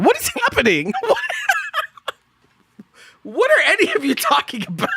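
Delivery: high-pitched, cracking up in disbelief; laughing bewilderment. Real, not acted. Streamer baffled by what chat is saying, laughing incredulously. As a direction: ask it helplessly through real laughter.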